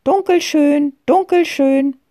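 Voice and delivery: high, far-carrying call for the cat; searching girl calling her cat. A narrator's reading. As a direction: call the cat's name into the bushes.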